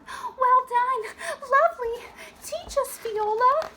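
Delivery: very high, loud admiring cheers; excited cheering. Acted. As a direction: crowd around her cheering. Princesses cheering the hero.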